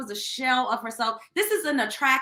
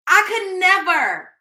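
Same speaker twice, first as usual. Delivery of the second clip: higher and louder, slow and stressed; upset outburst; 6 semitones higher, 7 dB louder, slower than usual. Real, not acted. Host reacting to the story, higher, louder outburst. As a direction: cry out I could never. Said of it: "This shit make me wanna cry".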